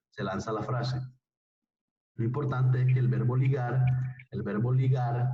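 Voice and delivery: ordinary talk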